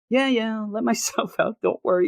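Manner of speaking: high, absent-minded reassurance; high distracted brush-off; dazed Kirk voice. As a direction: wave them off without a care. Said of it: "He keeps saying it".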